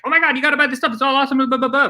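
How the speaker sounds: very high, loud, gushing pitch; overexcited salesperson. Shown as a demonstration. Sales coach mocks a pushy rep: high, loud gushing. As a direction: ham up the pushy salesman hype. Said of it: "don't do that".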